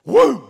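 sudden loud whoop as the fire falls; whooshing whoop. Acted. Preacher whoops as the fire consumes the soldiers. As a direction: let out a big whoop like fire crashing down. Fire comes down, all dead.

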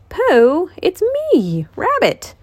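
high, rising little animal voice; lightly high Rabbit insisting he's himself. Acted. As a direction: pitch Rabbit up high and eager. Rabbit's lines in a story.

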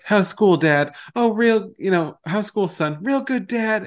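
playing both sides in a high mocking voice; a hammed-up Breakfast Club quote; mimicking Breakfast Club scene. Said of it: "Judd Nelson in The Breakfast Club is like".

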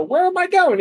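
high-pitched, lost and confused; retold bewildered question. Guest retells coming back unable to find the entrance, bewildered. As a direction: ask it high, as if totally lost.

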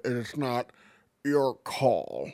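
put-on fat lawyer voice. Lawyer telling cops it's not their call, put-on lawyer voice. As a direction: play a rich, balding lawyer. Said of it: "lawyer voice".

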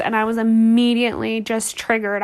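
ordinary talk, nothing marked

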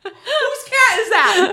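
slow, high, laughing question. Real, not acted. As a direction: drag it out through giggles.

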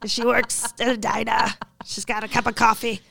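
hammed-up diner waitress old lady; old lady smoker. Host introduces her diner character to co-host, old lady smoker voice. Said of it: "my old lady who smokes a lot".

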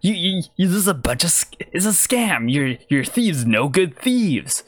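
high, stammering tirade of a cheated gambler; angry accusation. Acted. Losing gambler accuses the house of a scam, sputtering high outrage.

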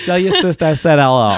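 put-on loud Boston accent, joining the joke; hammed-up Boston send-off. Joins in the Boston accent bit.

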